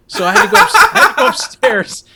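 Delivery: high, loud laugh through the words. Real, not acted. Telling a friend the punishment, laughing hard. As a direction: crack up while telling it.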